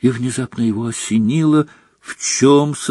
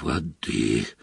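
low, very soft, hoarse croak; feeble croak; 11 semitones lower, 11 dB quieter than usual. A narrator's reading. Dumbledore coming round and asking for water, feeble croak. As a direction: rasp it faintly, barely voiced. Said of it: "прохрипел Дамблдор".

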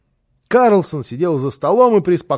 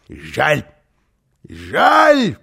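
mock regret; 3 dB louder than usual. Karlsson pitying the housekeeper, mock regret. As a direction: swoop up on the repeat.

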